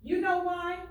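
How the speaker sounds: put-on high and loud, asking the kids; excited question. Teacher reading to her class, rising excited question. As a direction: pop the question up bright.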